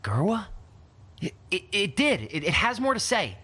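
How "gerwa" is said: name asked with rising tune; puzzled question. Acted. Durand hearing his friend's name, questioning. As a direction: ask it in mild disbelief.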